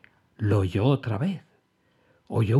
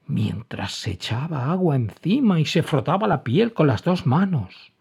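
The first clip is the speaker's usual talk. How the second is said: ordinary delivery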